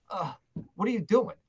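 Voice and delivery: high-pitched baffled question after a pause; stunned disbelief. Retold or imitated. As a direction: sound floored by what you heard. Retold reaction to the producer.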